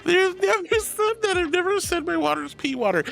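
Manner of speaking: high-pitched mock outrage; playful indignant denial. Real, not acted. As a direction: protest your innocence to teasing friends. Mock-indignant denial of chat's teasing.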